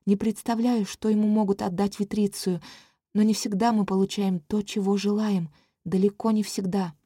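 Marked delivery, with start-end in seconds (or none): none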